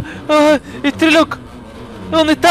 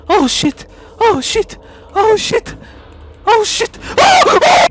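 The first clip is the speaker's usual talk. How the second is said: higher and louder each time; rising panic; 9 semitones higher, 5 dB louder, slower than usual. Real, not acted. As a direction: climb in panic with each one. Rising panic repeated curse.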